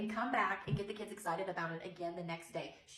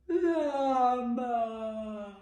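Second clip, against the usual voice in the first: long louder yawn; llama yawn; 3 semitones higher, 6 dB louder than usual. Shown as a demonstration. Teacher yawning llama for the kids. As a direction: yawn the word out slowly. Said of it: "Can you yawn".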